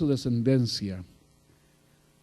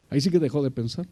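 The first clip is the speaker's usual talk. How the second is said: ordinary talk, nothing marked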